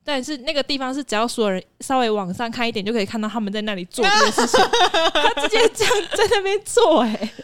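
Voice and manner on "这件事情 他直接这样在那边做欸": laughing through the line, pitched high and loud; can't hold back the laugh while telling it; giggling in disbelief. Real, not acted. Telling an outrageous story.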